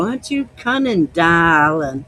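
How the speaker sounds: put-on playful Maine accent with "darlin'"; mock Mainer. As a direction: tease him like an old Maine lady. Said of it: "I go".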